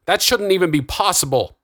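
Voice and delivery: high, disbelieving outburst; shocked cry. A narrator's reading. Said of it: "cried Augustus".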